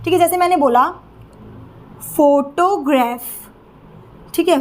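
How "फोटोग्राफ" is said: "Photograph" slowly, first syllable "फो" stressed. Shown as a demonstration. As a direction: lean on the opening "फो" of "photograph". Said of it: "हो पे sound पे ज़्यादा stress है". ("Photograph" में "फो" पर ज़ोर, धीरे)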